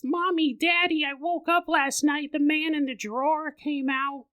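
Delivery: put-on high, slow, fading child's voice; a toddler tells something creepy; slow, high child voice, fading to a hush; eerie little girl. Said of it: "She goes".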